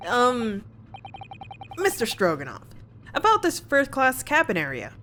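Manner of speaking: normal talking voice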